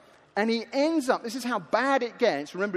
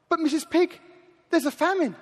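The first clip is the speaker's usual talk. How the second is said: lightly acted plea, pitched up; a hint of higher-voiced protest; pleading lost son; 6 semitones higher than usual. Lost son pleads with the pig, lightly voiced higher protest. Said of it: "And the lost son says".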